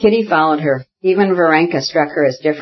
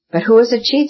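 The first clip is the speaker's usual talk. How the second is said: mildly reproachful, higher-pitched question; 8 semitones higher than usual. A narrator's reading. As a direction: ask it with a touch of hurt reproach. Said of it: "said Varenka reproachfully".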